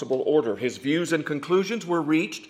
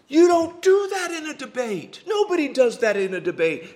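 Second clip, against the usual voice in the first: high, exclaimed disbelief, easing as it goes; mock outrage; 10 semitones higher, 4 dB louder than usual. Preacher reacting to the silent debater, in high mock outrage. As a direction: protest the broken rule in a high, incredulous voice.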